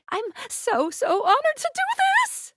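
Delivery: very high, gushing squeal; overcome with honor. Acted. Game character honored to perform the ritual, high emotional gush.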